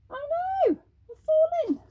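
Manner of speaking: very high panicky cry; alarmed witch. Acted. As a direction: shriek it as you fall. Broom snaps mid-flight.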